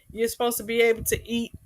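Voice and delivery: ordinary talk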